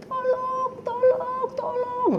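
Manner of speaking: high, thin mock cries for help; high imitated wail of tolong; crowd crying for help. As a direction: imitate lost souls calling out. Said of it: "semua orang berteriak".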